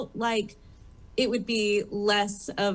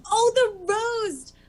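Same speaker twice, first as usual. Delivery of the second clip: surprised recognition; 12 semitones higher, 4 dB louder, much slower than usual. Real, not acted. Recalling the roast episode.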